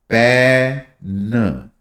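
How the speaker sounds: पेन drawn out very slowly; stretched demo. Shown as a demonstration. Teacher slowly repeating पेन for students. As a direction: hold the vowel of पेन long. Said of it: "तो इसमें".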